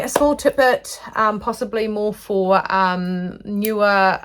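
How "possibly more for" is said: ordinary talk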